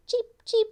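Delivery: very high tiny cheeping; teeny baby chick voice; chick cheeps. Pretend. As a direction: peep like a little chick. Voicing chick sound for kids.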